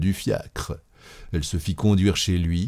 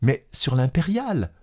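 higher-pitched woman's plea; anxious wife; 7 semitones higher than usual. A narrator's reading. Wife asking the conductor after her missing husband, anxious. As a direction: plead in a higher voice.

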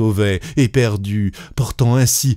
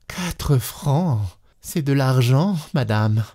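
resigned; 4 semitones higher, slower than usual. Acted. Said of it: "toujours résignée".

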